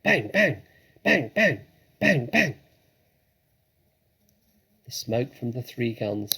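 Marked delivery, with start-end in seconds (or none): 0.0-2.5 punchy shouted bangs, higher and louder; loud gunshot bangs. A narrator's reading. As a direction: fire each bang like a shot. Narrator imitates gunshots.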